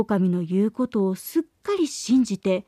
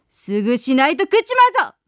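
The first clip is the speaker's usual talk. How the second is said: fast, louder menacing wolf threat; wolf bellowing; 4 dB louder, faster than usual. Acted. Wolf threatens the miller to hurry, angry shout. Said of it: "と怒鳴りました".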